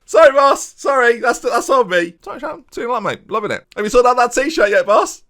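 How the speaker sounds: high, loud cartoonish footballer; squeaky player voice. Striker apologises to his manager, high squeaky voice. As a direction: play the eager striker pestering his boss.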